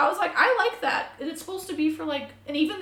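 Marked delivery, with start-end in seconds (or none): 0.3-1.1 high, louder delight; excited. Real, not acted. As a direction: gush about bigger bills. Excited about British money.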